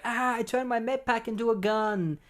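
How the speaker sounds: high, hammed-up cry of dismay; wailing wounded superhero, played for laughs; mock pained wail. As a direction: whine it in comic agony. Wounded hero wailing, retold.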